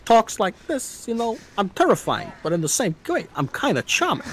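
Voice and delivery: Christopher Walken impression. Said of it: "I know that Christopher Walken sorta".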